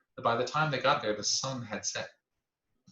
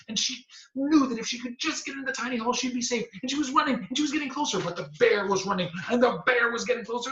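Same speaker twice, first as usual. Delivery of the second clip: higher, faster, building urgency; breathless chase; 11 semitones higher, 3 dB louder than usual. A narrator's reading. Narrator building tension as the bear chases the chipmunk. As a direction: race through the chase getting more frantic.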